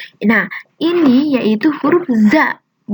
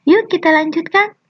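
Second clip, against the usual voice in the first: normal talking voice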